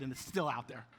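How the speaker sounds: usual speaking voice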